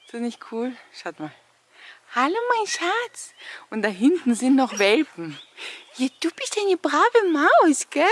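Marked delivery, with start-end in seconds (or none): 2.1-3.3 high sweet dog talk. Real, not acted. Greeting a dog. 6.0-8.0 high, slow praise for a pet; talking to a dog. Real, not acted.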